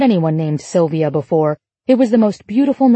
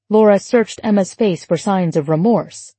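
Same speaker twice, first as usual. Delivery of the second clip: ordinary delivery, nothing marked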